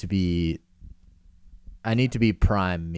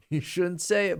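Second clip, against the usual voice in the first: mock scolding; 10 semitones higher than usual. Mock self-scolding quote.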